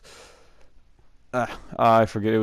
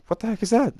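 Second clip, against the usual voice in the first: much higher, startled "what the heck"; a higher, startled question; surprised; 12 semitones higher, faster than usual. Retold or imitated. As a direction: react in sudden surprise at the blood. Spotting blood, puzzled.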